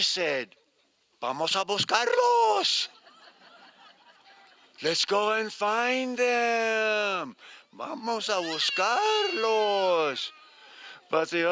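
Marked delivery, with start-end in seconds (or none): 1.2-2.9 very high, louder little chick, eager; tiny bird voice. Acted. 4.8-10.3 little bird. Acted.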